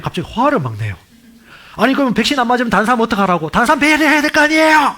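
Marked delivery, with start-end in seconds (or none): 1.8-5.0 a hometown friend angrily defending the vaccine, getting loud and shrill; angry retort. Retold or imitated. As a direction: voice climbing high and hot, rushed. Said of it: "막 성질을 막 내".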